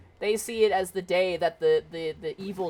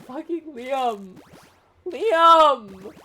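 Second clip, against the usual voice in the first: high, slow "Fucking Liam" rising to a loud shriek of "Liam!"; overwhelmed fangirl cry; 6 semitones higher, 6 dB louder, much slower than usual. Retold or imitated. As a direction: swoon and squeal his name. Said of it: "And I'm like".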